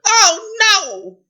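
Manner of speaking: high, loud wail; hammed-up cry of dismay; horrified princess. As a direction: wail in royal horror. Princess horrified at being frog.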